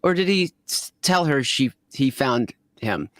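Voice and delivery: usual speaking voice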